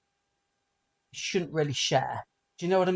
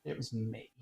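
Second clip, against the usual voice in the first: quiet confession; 6 semitones lower, 10 dB quieter than usual. Retold or imitated. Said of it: "And then I just went".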